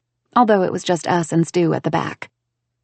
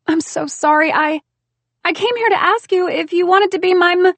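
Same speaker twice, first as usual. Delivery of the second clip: high and louder, upset and rushed; pleading apology, choked up; tearful apology; 11 semitones higher, 6 dB louder than usual. Acted. To her sister, a high tearful apology.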